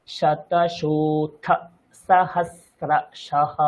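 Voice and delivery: শতশোথ with hushed শ, সহস্রশঃ with crisp dental স; slow, keeping both স apart; two s sounds. Shown as a demonstration. Teacher contrasting two স sounds in a word. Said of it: "দুটো স কিন্তু এখানে মিশে আছে".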